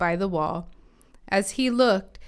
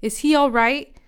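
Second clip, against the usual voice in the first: higher, louder rising question; demanding; 6 semitones higher, 5 dB louder than usual. A narrator's reading. King asking the second messenger, demanding. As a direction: demand an answer, voice lifted. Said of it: "the king demanded".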